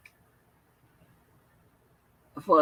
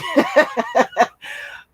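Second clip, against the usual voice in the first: real rapid burst of laughter; higher, louder run of giggling; laughing; 5 semitones higher, 5 dB louder than usual. Real, not acted. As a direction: break into a quick laugh. Laughs during livestream chat.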